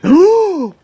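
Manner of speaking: high, loud mock gasp; big gasp. Player reacts to the quest ending with a mock gasp. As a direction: gasp in hammed-up shock.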